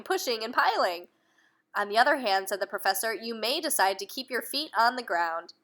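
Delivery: put-on reading aloud to children; lively bedtime-story narration; storybook reading. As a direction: tell the story to little ones. Reading a picture book aloud.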